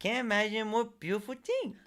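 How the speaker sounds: high, dreamy quoted line; dreamy character voice. Retold or imitated. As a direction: gush it like a cartoon character. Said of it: "That's my favorite line".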